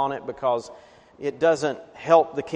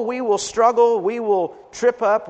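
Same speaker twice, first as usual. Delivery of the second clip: ordinary talk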